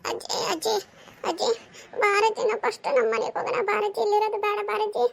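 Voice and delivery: put-on high, crying child's plea; acted tearful pleading in a high voice. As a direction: whimper and beg grandmother to come away. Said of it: "ಅಳ್ಬೇಡ".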